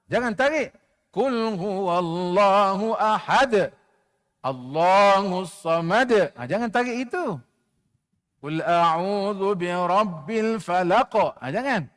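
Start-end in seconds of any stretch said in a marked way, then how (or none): 1.1-6.1 slow chanted tajwid recitation; Quran recitation. Shown as a demonstration. Leads class Quran recitation. 8.4-11.1 slow chanted tajwid recitation; Quran recitation. Shown as a demonstration. Leads class Quran recitation.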